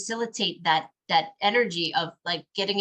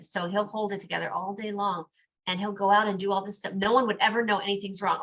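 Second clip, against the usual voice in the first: usual speaking voice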